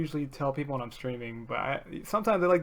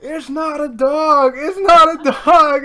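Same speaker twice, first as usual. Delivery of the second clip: high, loud, slow panicked shout; panicked yell; 10 semitones higher, 13 dB louder, much slower than usual. Retold or imitated. Son keeping the phone from mom, panicked slow yell. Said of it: "And I go".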